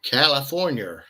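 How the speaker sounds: drawn-out mock American accent; attempted Californian accent, slow; put-on Californian accent. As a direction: stretch it out in a playful American accent. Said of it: "that's not Californian accent".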